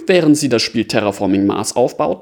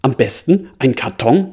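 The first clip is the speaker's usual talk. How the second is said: ordinary delivery, nothing marked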